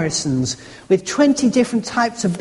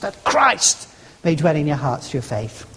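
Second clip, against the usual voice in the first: "Christ" punched out firmly, the rest dropping lower; stress on Christ; 6 semitones lower than usual. Shown as a demonstration. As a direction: make "Christ" the one word that counts, then fall away. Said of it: "nothing else but Christ".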